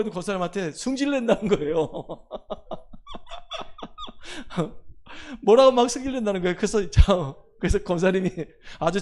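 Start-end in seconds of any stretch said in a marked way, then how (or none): 1.9-5.4 long, high real laughter; amused laugh. Real, not acted. Pastor on the contractor's temper, long high laugh. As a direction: laugh helplessly at the absurdity.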